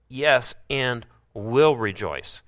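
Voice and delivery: WILL stressed, slow; slow emphatic will. Shown as a demonstration. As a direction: dwell on WILL, slowly. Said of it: "In this I rejoice".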